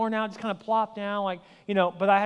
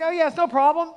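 breezy confidence; 8 semitones higher, 4 dB louder than usual. Retold or imitated. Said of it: "He's like".

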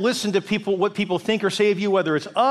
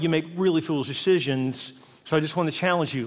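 normal talking voice

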